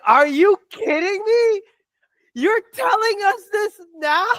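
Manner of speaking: high-pitched, disbelieving retold reaction; incredulous outburst. Fan reacting to late news of a dispute, incredulous. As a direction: squeal it in exasperated disbelief.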